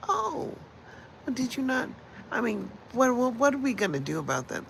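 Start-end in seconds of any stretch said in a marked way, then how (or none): 0.1-1.9 mock incredulous. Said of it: "And I'm saying".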